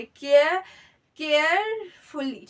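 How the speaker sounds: Carefully drawn out slowly, part by part; syllable-by-syllable demo. Shown as a demonstration. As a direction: stretch carefully to show its separate syllables. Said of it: "तीन syllable की जरूरत".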